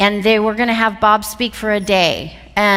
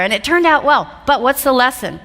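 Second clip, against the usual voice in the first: ordinary talk, nothing marked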